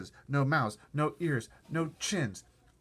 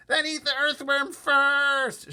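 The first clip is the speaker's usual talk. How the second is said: high, loud and slow shout; 10 dB louder, much slower than usual. Acted. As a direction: bellow it out, dragging each word. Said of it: "shouted the centipede".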